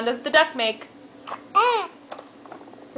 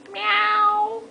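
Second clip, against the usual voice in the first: cat meow; 7 semitones higher than usual. Shown as a demonstration. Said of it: "what sound does the kitty cat make?".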